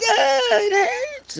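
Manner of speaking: very high, far-off woman's cry, slow; mock woman crying out. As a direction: squeal in fake pain like a burned woman. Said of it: "she's like".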